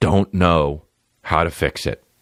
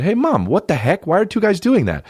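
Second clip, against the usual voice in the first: put-on much higher, puzzled child voice; kid asking mom; 10 semitones higher than usual. Boy asking his mom about the TV, high and puzzled. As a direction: ask like a confused little boy. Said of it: "to my mom, and I said".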